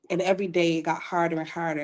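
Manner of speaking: usual speaking voice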